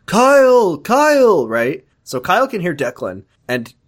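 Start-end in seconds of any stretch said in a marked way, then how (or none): 0.1-1.5 loud, high, drawn-out call. Retold or imitated. Declan calling to Kyle from out by his car, imitated distant holler. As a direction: holler the name like calling someone far off. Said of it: "you hear Declan go like".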